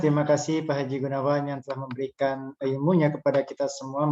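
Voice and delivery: ordinary talk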